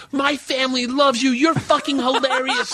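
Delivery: high, breathless superfan gush; shrill excited fan voice over Oliver Stone. Retold or imitated. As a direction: squeal it like a starstruck handler. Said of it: "she's like".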